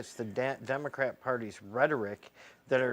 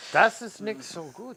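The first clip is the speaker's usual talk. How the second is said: higher-pitched mock German accent; 8 semitones higher than usual. Guest judges host's accent demo, mock German. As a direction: play along in put-on German.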